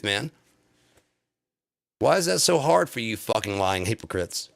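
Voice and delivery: ordinary delivery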